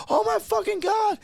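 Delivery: mock-horrified gasp of disbelief; line cooks shocked. Kitchen line sees the fryer flare up, high shock. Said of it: "they're like".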